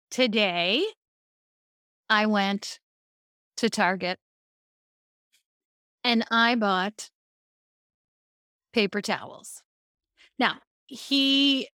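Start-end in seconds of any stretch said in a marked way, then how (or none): 0.1-9.6 slow, with long deliberate pauses. Shown as a demonstration. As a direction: pause in place of every filler. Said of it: "I would tell my husband Eric stories like this".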